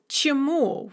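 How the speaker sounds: "чему" stressed on the last syllable, chi-MU; stress on final u. Shown as a demonstration. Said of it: "with the stress on the u".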